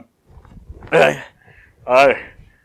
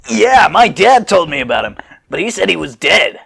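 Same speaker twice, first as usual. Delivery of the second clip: put-on kid voice; 4 semitones higher, 7 dB louder than usual. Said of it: "Goten's age versus Trunks' age".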